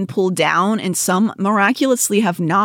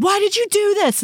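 mock parent yelling. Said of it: "said to the kid".

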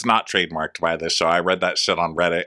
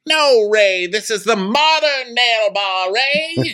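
very high, loud, hammed-up wife calling out; Karen; 4 dB louder, slower than usual. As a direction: sing it out high to your husband. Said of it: "it's Karen and Ray".

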